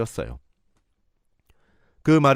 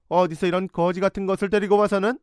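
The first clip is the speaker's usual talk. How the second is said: much higher, scornful; mother sneering at her son's girlfriend, much higher; contemptuous sneer; 9 semitones higher than usual. Retold or imitated. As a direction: spit the insult out.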